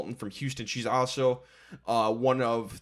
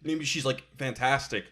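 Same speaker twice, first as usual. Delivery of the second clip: normal talking voice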